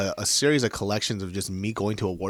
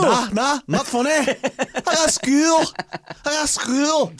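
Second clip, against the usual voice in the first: very high-pitched, stretched-out parody; high mock voice; 4 dB louder, much slower than usual. Co-host copying the silly bit, high drawn-out voice. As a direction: mimic it in a squeaky put-on voice.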